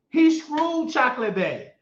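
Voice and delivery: usual speaking voice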